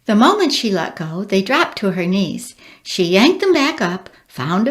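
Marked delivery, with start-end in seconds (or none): none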